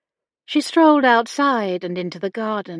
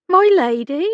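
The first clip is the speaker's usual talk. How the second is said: Becky's voice; 8 semitones higher, 6 dB louder than usual. A narrator's reading. Becky calls to distracted Hannah.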